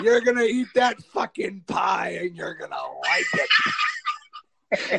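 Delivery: loud, slow mock bullying; hammed-up domineering demand; retold forceful order.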